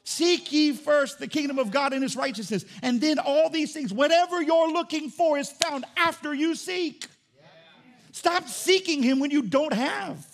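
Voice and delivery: high, loud, slow preaching; full-force preacher voice. Acted. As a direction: build to a peak and hammer it home. Preaching climax.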